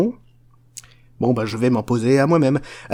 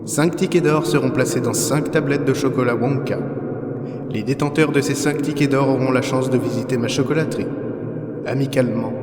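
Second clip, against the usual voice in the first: a lightly grand, formal announcement; formal letter read. Acted. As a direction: read the invitation like a showman, with a little pomp. Reads his golden ticket letter.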